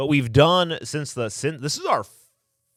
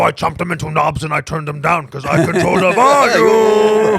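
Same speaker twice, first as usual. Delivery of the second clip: Cookie Monster voice; 4 semitones higher, 9 dB louder than usual. Acted. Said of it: "They said".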